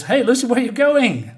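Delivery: loud, high call after someone. Acted. Gang leader calls after the fleeing Lucy, loud and high. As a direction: shout after her as she rides away.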